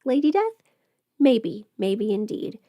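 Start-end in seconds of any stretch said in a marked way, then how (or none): none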